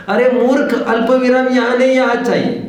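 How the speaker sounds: high-pitched, insistent mock rebuke; acted scolding. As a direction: scold a fool over misplaced punctuation. पूर्ण विराम न समझने पर डाँट. (ऊँचे सुर में बनावटी डाँट)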